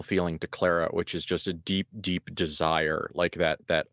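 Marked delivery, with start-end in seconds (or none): none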